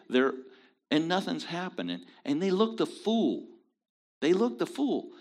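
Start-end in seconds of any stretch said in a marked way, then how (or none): 2.2-5.1 ridicule. Real, not acted. Preacher ridicules the prophets.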